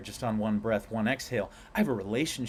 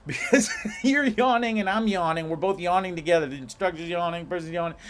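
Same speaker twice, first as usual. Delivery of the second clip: amused and louder; laughing; 6 semitones higher, 6 dB louder than usual. Real, not acted. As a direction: laugh it out, tickled by the picture. Laughing about yawning with clients.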